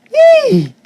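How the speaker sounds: high, loud happy yelp; a burst of delight; excited. Real, not acted. Excited at poster arriving.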